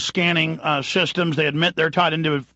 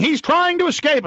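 mock guard yelling; 11 semitones higher than usual. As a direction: ham up a panicking guard shouting. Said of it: "And then just yelling".

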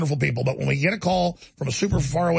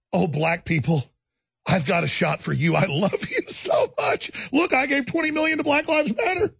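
hammed-up fake affection, climbing higher; Gates; 6 semitones higher than usual. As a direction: start measured, build to a high mock gush. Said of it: "meanwhile, he's like".

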